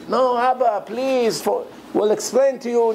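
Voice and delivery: high, desperate begging; pleading. Retold or imitated. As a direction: beg him to hear you out. Son begging his father.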